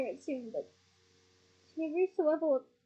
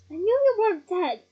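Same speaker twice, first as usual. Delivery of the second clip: raised, very loud protest; bellowed retort; 4 semitones higher, 10 dB louder than usual. A narrator's reading. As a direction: bellow over the other person. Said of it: "bellowed Ron".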